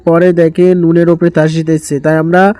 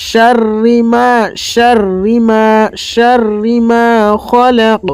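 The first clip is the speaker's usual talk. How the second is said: slow, higher melodic tajweed chant; Quran recitation; 3 semitones higher, much slower than usual. Shown as a demonstration. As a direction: recite it slowly, stretching the vowels. Teacher recites verse lines. (ধীর সুরেলা তিলাওয়াত)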